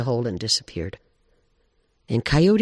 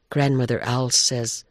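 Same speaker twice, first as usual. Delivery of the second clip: ordinary delivery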